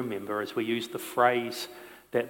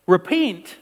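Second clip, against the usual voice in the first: high, loud, slow preaching; fiery pulpit sermon, drawn out; full-force preaching; 12 semitones higher, 7 dB louder than usual. Acted. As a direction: preach it out to the whole room.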